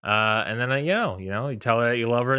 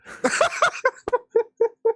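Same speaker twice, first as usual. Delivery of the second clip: high-pitched burst of laughter. Real, not acted. Laughing after the quote.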